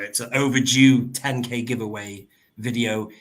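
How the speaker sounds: ordinary talk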